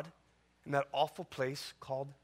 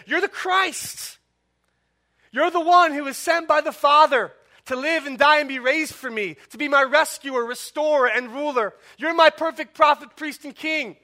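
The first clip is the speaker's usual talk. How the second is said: higher, louder, fervent declaration; Peter's confession; 10 semitones higher, 14 dB louder than usual. Pretend. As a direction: proclaim it like Peter confessing Christ. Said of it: "your response is like Peter".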